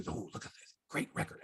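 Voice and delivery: sarcastic aside. Acted. Mock praise of stats.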